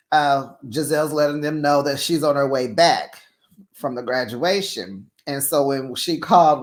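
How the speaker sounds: normal talking voice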